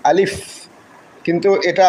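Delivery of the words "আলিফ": "আলিফ" with a long audible final f; standalone alif. Shown as a demonstration. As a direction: say "আলিফ" fully, letting the f hiss out. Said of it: "single আলিফ উচ্চারণ করতে".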